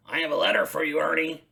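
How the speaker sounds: a friendly delivery man's call; mailman. Acted. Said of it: "said the mailman".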